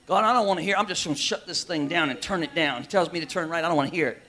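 lightly voiced stubborn sinner shutting God out; sulky believer. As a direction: mutter it like someone ignoring God. Voicing a reluctant believer.

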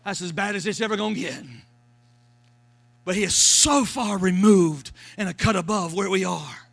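usual speaking voice

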